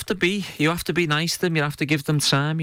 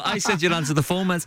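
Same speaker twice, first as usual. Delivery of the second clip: usual speaking voice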